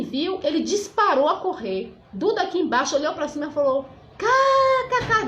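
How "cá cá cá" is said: high mock belly laugh, spelt out; imitated guffaw. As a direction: imitate someone bursting out laughing. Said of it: "deu uma risada".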